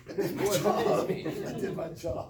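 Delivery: slow, loud and laughing; drawn-out self-defence through laughter; laughing protest. Retold or imitated. Kid defending herself as dad cusses out cousin, laughing.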